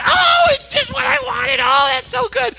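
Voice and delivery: mock gushing squeal, very high; overjoyed. Woman hugging her friend for the gift, overjoyed. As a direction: gush in a high, thrilled imitation of her. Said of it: "throwing her arms around him saying".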